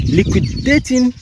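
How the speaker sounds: "Liquidating" said slowly, high and loud, every syllable pressed; emphatic quote. Retold or imitated. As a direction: hit "Liquidating" hard and slow as the key word of the quote. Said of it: "an emphatic statement".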